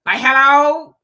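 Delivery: loud, high incredulous hello. Real, not acted. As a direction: throw it out in disbelief. Incredulous retort.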